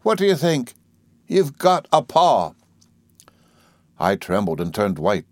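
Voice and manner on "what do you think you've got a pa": high nurse breaking big news; breathless. A narrator's reading. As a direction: build to the news, drawing it out. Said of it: "speaking in a breathless sort of way".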